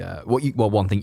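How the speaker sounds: ordinary delivery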